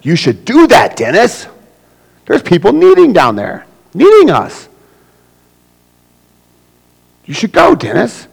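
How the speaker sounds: high and loud, bossing Dennis; pushy know-it-all. Acted. Churchgoer pressing Dennis to go to Sardis, high and bossy. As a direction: lean in and tell him what God said.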